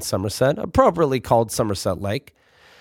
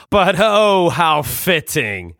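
stuffy dad voice; 3 semitones higher, much slower than usual. Retold or imitated.

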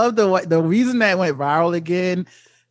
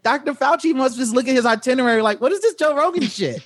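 higher, fast, exasperated scientist; an annoyed Fauci fed up with the podcast; imitating Fauci; 6 semitones higher than usual. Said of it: "I was like".

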